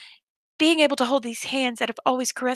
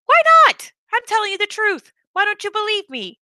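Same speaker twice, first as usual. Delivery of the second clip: very high, loud pleading protest; whiny doll; 10 semitones higher, 5 dB louder than usual. Acted. Doll protests being doubted.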